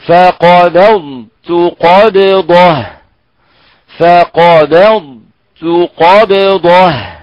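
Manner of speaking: فقبضت قبضه drawn out very slowly; slow Quranic model reading. Shown as a demonstration. Teacher reciting the verse slowly for students to repeat. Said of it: "فننتبه".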